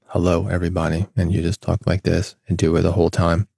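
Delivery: low, droning monotone, no pitch movement at all; monotone demo. Shown as a demonstration. Host demonstrating a boring monotone voice. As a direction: show listeners how a dull, flat host sounds. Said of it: "If I was very monotone".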